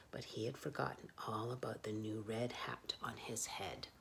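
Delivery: whispered, tense and secret; hushed whisper. A narrator's reading. Narrator whispers the danger to children. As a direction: breathe it like a secret.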